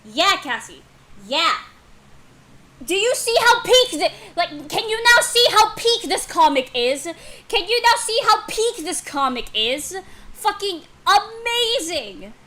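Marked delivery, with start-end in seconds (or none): 2.8-12.3 loud, high, emphatic gushing; excited raving. Real, not acted. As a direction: rave about it at full volume. Raving about the comic.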